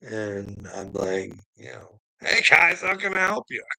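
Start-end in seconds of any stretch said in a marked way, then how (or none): 2.2-3.6 high, sing-out innocent hello; mock-polite greeting. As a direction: greet the officers helpfully, pitched right up. Greeting police at the door.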